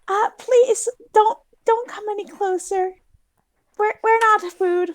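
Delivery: high, slow, timid plea; pleading voice. Acted. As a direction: beg the crocodiles gently. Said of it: "and tell them".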